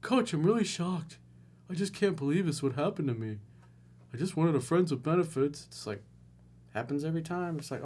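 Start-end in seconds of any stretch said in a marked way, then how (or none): 0.0-5.6 a hint of a whiny, wounded caller; mock whiny caller. Coach imitates the caller, lightly whiny. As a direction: play the naive guy who can't believe it. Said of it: "you can tell he's like".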